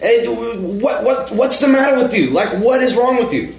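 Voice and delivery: high mock yell of an angry attendant; furious yell. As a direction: blast it like an outraged Jersey pump attendant. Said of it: "this guy is just so fucking pissed".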